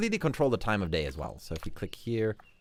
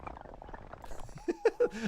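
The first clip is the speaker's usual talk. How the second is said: high giggle; 5 dB quieter than usual. Real, not acted. Laughing at the crazy mountain.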